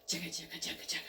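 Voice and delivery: quiet chugga chugga like a steam engine; soft train chug. Pretend. Narrator makes the pistons chug, quietly. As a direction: murmur the engine's rhythm.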